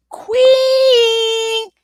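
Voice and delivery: high, loud ringing shout on queen; triumphant belt; belted word. Acted. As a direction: crown yourself with a shout.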